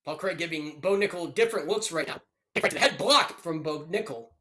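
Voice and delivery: live fight calls, rising with the action; play-by-play commentary. Acted. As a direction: narrate the round like a ringside caller.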